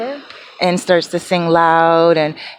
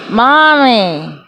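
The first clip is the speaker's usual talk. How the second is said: higher, louder mock calling out; calling Mommy; 4 semitones higher, 4 dB louder, much slower than usual. Imitating her friend waking her at night, mock calling out. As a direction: call her awake like a child. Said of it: "all of a sudden I hear".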